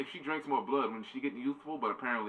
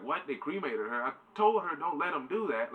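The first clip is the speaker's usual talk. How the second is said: mock outrage; 7 semitones higher, 4 dB louder than usual. Retelling Dracula's outraged reaction.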